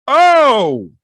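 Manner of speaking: high, loud "Oh!"; loud exclamation of astonishment; shocked shout. Real, not acted. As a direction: cry out in surprise, high then falling. Reacting to a sudden attack.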